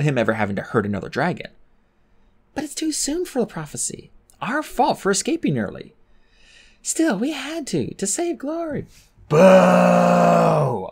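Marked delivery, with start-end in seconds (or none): 2.5-8.9 put-on young dragon's thought. A narrator's reading. Clay thinking about the prophecy, rueful. 9.3-10.9 loud jeering boo, higher; crowd booing. A narrator's reading. Crowd booing the dragonets, loud. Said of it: "more dragons in the crowd shouted".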